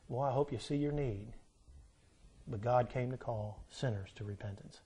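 hushed closing appeal of a sermon; soft altar call. Acted. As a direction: coax them gently toward the call.

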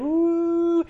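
high, sustained "woo" like a siren; imitated siren wail. Preacher imitating county tornado sirens, high drawn-out wail.

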